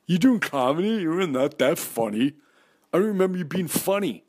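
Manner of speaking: put-on high, scoffing girl's voice; mocking Dee. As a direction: scoff at him like a doubtful old friend. Dee mocking his comedy.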